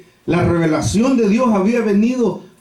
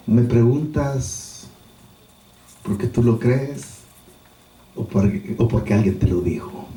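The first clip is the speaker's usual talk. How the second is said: meek and humble; 6 semitones lower, 4 dB quieter than usual. Acted. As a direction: answer gently, unhurried, with long pauses. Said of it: "Su mansedumbre, su humildad".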